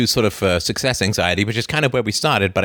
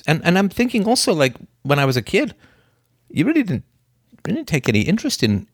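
lightly acted son confronting his dad, higher pitch; role-played hurt son; 5 semitones higher than usual. Son telling his dad what bothers him, role-played hurt. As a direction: raise the pitch, haltingly voice a son's complaint. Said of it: "I say, I won't do your accent".

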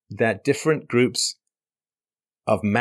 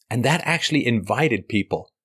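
normal talking voice

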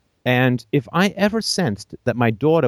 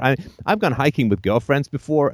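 ordinary delivery

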